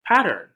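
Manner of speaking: Pattern said high and loud; big announcing voice; emphatic title word. Acted. Dramatic title word read aloud.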